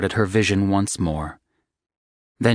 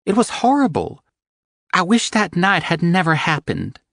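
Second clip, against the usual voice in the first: higher voice, upset and regretful; young woman voice, higher and troubled; distraught young woman; 11 semitones higher, 5 dB louder than usual. Acted. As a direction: speak as the heroine, close to tears. Evangeline's voice in story.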